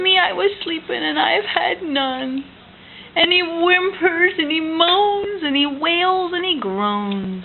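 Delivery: high, whiny bear voice; bear's complaint. Acted. Parent voicing the grumpy bear for toddler, whiny. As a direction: whine it like a wronged bear.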